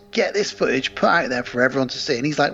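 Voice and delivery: ordinary talk, nothing marked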